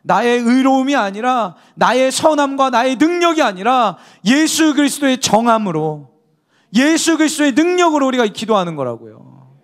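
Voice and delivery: loud, high, impassioned sermon delivery; fervent preaching. Acted. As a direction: preach it with rising fervor. Preaching at full force. (힘 있게 외치는 설교)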